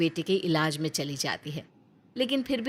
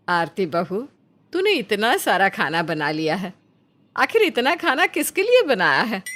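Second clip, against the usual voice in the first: rising, louder suspicious mother-in-law; questioning mother-in-law; 2 semitones higher, 5 dB louder than usual. Acted. Mother-in-law Nandita questioning her daughter-in-law about food, suspicious. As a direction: demand to know who all this food is for.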